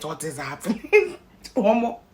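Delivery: high laughing disbelief; stunned giggle at the glare; amazed laugh. Retold or imitated. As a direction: marvel out loud and laugh. Reacting to bright theatre.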